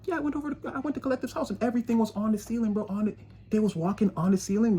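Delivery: high, soft, quick gossip; hushed disbelief. Retold or imitated. Person in the background telling a friend, hushed and amazed. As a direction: tell it like a wild rumour to a friend.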